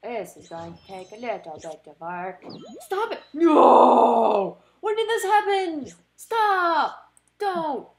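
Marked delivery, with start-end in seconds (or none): 2.8-7.9 high loud wailing shout; crying shout. Real, not acted. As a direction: scream it in despair. Screaming as Pokemon hurt.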